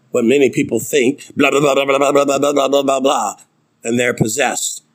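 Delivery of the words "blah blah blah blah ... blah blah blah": rapid monotone babble of blahs; mock gibberish. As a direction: babble nonsense in one unbroken run. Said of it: "It's not just gibberish talk".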